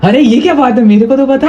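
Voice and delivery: high-pitched playful complaint; playful protest. Real, not acted. Playful protest at being left out. (ऊँची आवाज़ में मज़ाकिया शिकायत)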